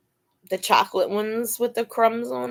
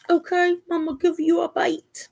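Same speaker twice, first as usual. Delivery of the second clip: pet talk; 8 semitones higher than usual. Real, not acted. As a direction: coax the dog up high. Promising dog a bite.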